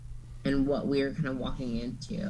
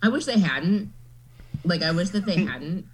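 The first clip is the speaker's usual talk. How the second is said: ordinary talk, nothing marked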